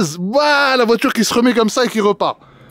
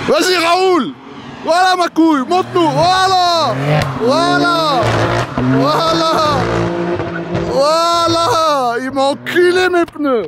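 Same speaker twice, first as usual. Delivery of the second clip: excited cheering; 7 semitones higher, slower than usual. Real, not acted. Cheering the car drifting past.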